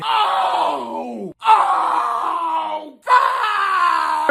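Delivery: high yelps of burning pain; pained shouting. Real, not acted. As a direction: cry out as the spice hits. Mouth burning from spice.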